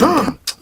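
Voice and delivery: sharp fake gasp; mock gasp.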